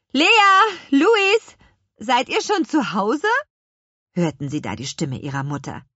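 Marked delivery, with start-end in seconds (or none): 0.1-3.3 put-on high, loud call for the children; singing-out parental call; mother calling. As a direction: holler names across the yard.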